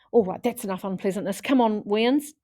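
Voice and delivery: put-on Scottish mum rounding up the boys; thick Scottish accent. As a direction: herd the kids along in a Scottish accent. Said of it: "in her thick Scottish accent".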